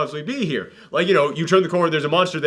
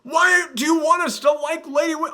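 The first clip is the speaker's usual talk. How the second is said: high-pitched, incredulous rant; exasperated; 10 semitones higher than usual. Real, not acted. Reviewer baffled they want us to like Lady Winchester, exasperated. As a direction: protest in baffled disbelief.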